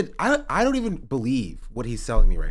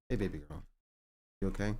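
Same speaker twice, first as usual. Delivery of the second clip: soothing; 3 semitones lower, 7 dB quieter than usual. Real, not acted. Soothing the dog afterwards.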